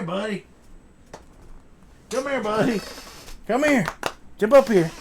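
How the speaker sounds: high, loud sing-song coaxing of a pet; calling the dog. Real, not acted. Calling his dog to the camera.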